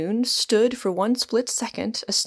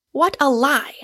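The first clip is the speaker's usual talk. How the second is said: higher, louder exclamation; cried out; 6 semitones higher, 6 dB louder than usual. A narrator's reading. Michael challenging Rosamond's denial, crying out. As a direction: cry it out in challenge. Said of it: "cried Michael".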